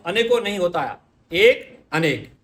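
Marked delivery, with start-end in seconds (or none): 1.3-2.3 एक and अनेक set apart slowly; slow contrast एक अनेक; slow emphatic demo. Shown as a demonstration. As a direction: say एक, pause, then अनेक with weight. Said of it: "तो इसका होगा अनेक".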